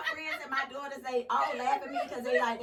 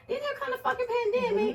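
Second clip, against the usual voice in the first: high, fast and laughing; laughing outburst; 12 semitones higher, faster than usual. Real, not acted. Laughing outburst about the pandemic.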